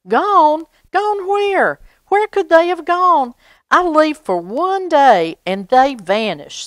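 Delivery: high, loud alarmed cry; the old dog crying out that the kittens are gone; shocked disbelief. A narrator's reading. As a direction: panic rising as it sinks in. Baxter learns kittens are gone.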